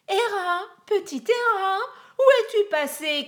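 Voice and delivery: high, loud mock lament; a liar calling for the lost baby, wailing; hammed-up fake wail for the baby; fake crying call. A narrator's reading. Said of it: "criait-il partout".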